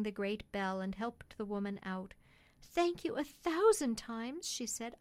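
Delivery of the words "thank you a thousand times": high, slow, heartfelt woman's voice; grateful mother. Acted. Woman thanking cabman at the hospital, high and grateful.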